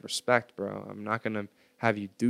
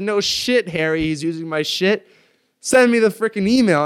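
high, loud furious outburst; retold furious yelling; going ballistic; 9 semitones higher, 11 dB louder than usual. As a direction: explode at him, louder on the last line. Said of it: "and I go ballistic".

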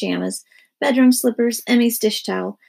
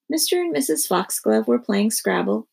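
plain reading voice

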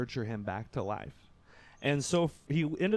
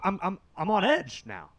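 ordinary talk, nothing marked

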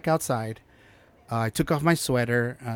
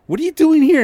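shocked outburst; 12 dB louder than usual. Retold or imitated. Retold shock at the burning house.

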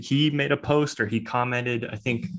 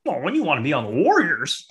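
swooping, higher-pitched mocking broadcaster voice; 4 semitones higher than usual. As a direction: heckle like a pompous commentator.

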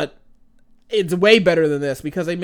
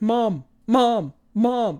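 put-on pestering kid; 7 semitones higher, much slower than usual. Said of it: "Family Guy where he's like".

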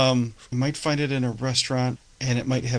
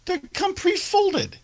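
jokey high-pitched objection; high squeaky protest; slower than usual. Acted.

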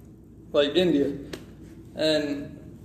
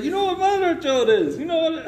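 put-on much higher, delighted Indian accent; gleeful scammer; 11 semitones higher than usual. Scammer amused by the caller's slang, high gleeful Indian accent. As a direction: ask with giddy glee. Scammer laughing over insult.

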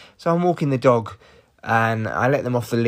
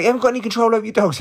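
high, fast, louder mock scold; mock neighbour scolding; 10 semitones higher, 4 dB louder, much faster than usual. Neighbour rebuking him over the dogs, high fast mock scold. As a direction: squawk it like the ranting neighbour. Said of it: "she's like".

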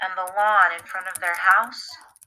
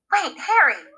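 calls after him, higher and raised; wife cries out as husband leaves, urgent; crying out; 7 semitones higher than usual. Acted. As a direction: call out to stop her husband leaving. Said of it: "his wife cried".

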